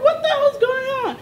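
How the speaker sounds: high-pitched, incredulous question; startled, high-pitched disbelief; retold bewilderment. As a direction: relive being baffled by the commotion. Retold alarm at girl screaming.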